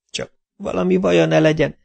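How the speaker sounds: put-on high, anxious little girl out of breath; mildly breathless, worried child; panting. A narrator's reading. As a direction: pant out a worry, pitched high. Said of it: "lihegte a kislány".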